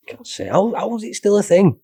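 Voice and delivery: high exasperated disbelief. Real, not acted. Exasperated at an old topic.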